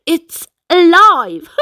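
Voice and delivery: put-on startled kid finding a live crab; startled cry. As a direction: jump back at the moving shell. Hermit crab in shell.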